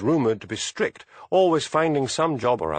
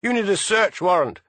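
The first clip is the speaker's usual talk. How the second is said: higher, louder protest; shouting; 9 semitones higher, 4 dB louder than usual. A narrator's reading. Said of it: "shouted Harry".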